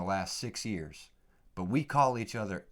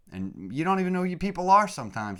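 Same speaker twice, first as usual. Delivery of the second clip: emphatic outburst; 10 semitones higher, 7 dB louder, faster than usual. Real, not acted. Sudden emphatic outburst to listeners.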